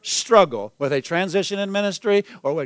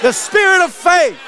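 shouted praise; 10 semitones higher, slower than usual. Real, not acted. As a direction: lift the whole room with it. Preacher's climax to congregation.

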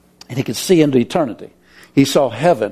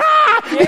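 very high shrieked Ah; nurses scream. Retold or imitated. Nurses seeing dead man wake, shrieking. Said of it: "and they went".

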